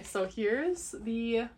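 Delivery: ordinary talk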